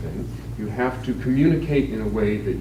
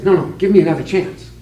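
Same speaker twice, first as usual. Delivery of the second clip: pleading; 7 semitones higher, 6 dB louder, faster than usual. Acted. Man begs the angry bartender.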